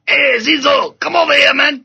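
high, loud mock call; mock shout across the room, high and loud. Mock calling out.

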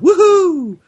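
high, drawn-out gleeful whoop; mock cheer. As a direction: cheer like a thrilled shopper, hammed up. Mock cheer at getting cheap iPod.

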